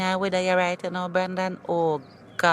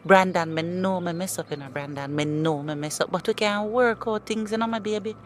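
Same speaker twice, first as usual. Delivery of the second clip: pleading. Acted. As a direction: plead on your knees to get him back. Ex begging to be taken back.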